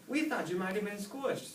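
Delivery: high, anxious mom voice; fretful mother relieved; worried snail mom. A narrator's reading. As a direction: mom telling Oscar her fears.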